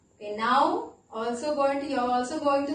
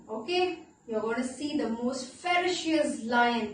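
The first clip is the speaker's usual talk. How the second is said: ordinary delivery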